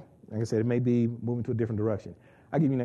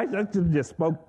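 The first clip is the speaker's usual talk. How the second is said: normal talking voice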